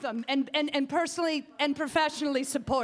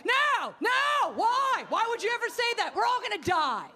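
much higher, shouted panic; panicked yelling; 8 semitones higher than usual. Retold or imitated. Comic yelling at the debate on TV, panicked. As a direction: yell at the TV in alarm. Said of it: "and I go".

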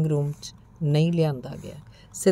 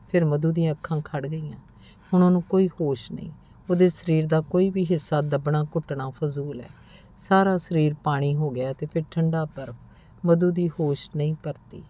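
plain reading voice

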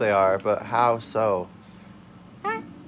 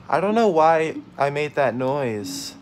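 ordinary delivery

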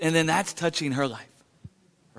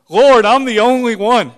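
higher and louder, a touch of mock despair; Elijah's lament; 7 semitones higher, 11 dB louder than usual. Elijah complaining to God he's alone, hammed-up lament. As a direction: cry out to the Lord like the lone prophet.

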